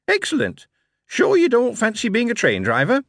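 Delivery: put-on high chirpy young clerk; bright, quick recruiting officer; high eager Billy voice. Billy speaks.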